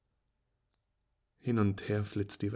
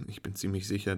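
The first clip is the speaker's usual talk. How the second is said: ordinary delivery, nothing marked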